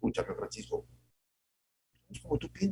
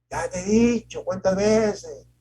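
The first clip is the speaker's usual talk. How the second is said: much higher, louder and slower, an exasperated dad; scolding father; 9 semitones higher, 7 dB louder, slower than usual. Retold or imitated. A father scolding his son about repeated advice, exasperated. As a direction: nag like a fed-up parent. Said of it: "dichos que nos enseñó nuestro papá".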